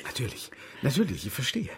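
leise eifrig geflüstert; flüstere die Zustimmung hastig. Acted. Geheime Absprache, flüsternd. (geflüsterte Zustimmung an Saccard)

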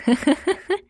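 short high laugh. Acted. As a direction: laugh it off brightly. Laughing at sly request.

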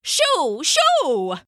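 high, falling, drawn-out shoos; shooing cry. Acted. Haddo driving the mouse from his bed, shooing cry.

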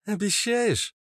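very high female voice; high falsetto question; Natasha. A narrator's reading. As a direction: ask like a hopeful sweetheart. Natasha asks for a promise.

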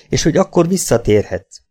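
plain reading voice

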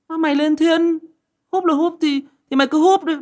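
high, loud exasperated reply; exasperated big-brother scolding; heated retort. Retold or imitated. Retelling his heated reply.

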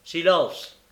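loud, severe order; stern command. Acted. Bumble silencing Noé and Charlotte, stern. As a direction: cut them off with one stern word. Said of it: "dit sévèrement le bedeau".

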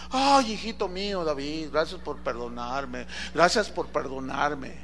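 low, soft acted thanks; humbled gratitude. Saúl thanks David for sparing him, soft humbled voice. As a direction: drop to a soft, grateful murmur.

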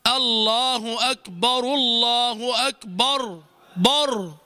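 drawn-out call with thick "bor" in أكبر; heavy B. Retold or imitated. Teacher imitating muezzins' mistake, heavy B. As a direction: fatten بر in a slow adhan chant. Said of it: "هكذا يؤذنون".

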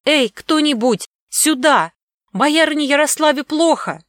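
loud, high, scared call for help; frightened shout. Acted. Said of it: "громко испуганно закричала". (испуганный громкий крик)

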